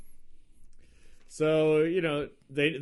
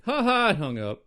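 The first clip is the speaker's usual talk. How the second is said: mocking taunt; 8 semitones higher, 5 dB louder than usual. Imitates show hanging up on caller.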